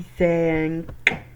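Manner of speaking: "ثانك" very slow and loud, long open a; vowel stretched downward. Shown as a demonstration. Teacher drilling the word, "ثانك" loud with a long stretched vowel. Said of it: "بمد لتحت".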